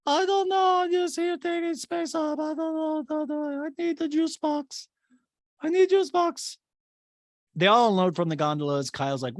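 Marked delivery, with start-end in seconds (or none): 0.1-6.6 Diana. Retold or imitated. Podcaster mimicking Diana looking helpless, high babyish whine. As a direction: whine in a tiny mock voice. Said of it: "Diana just appears helpless".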